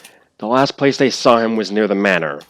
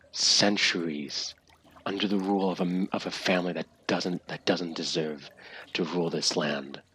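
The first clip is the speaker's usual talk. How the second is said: calm caring mentor; 3 semitones lower than usual. Acted. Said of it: "completely calm and caring voice".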